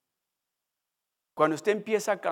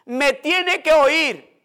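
loud, slow, higher insistent yell; slow, pleading outcry, louder; yelling harder; 9 semitones higher, 9 dB louder, much slower than usual. Acted. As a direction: shout it so he has to hear. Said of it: "Gritaba más duro".

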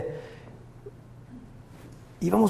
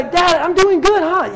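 proud little son; 8 semitones higher, much slower than usual. Retold or imitated. Proud son voiced in story.

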